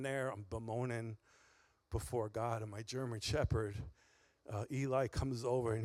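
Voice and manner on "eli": ordinary talk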